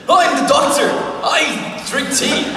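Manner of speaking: loud, high, hammed-up British accent. Shown as a demonstration. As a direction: belt out a slow, exaggerated British impression. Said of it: "okay, British?".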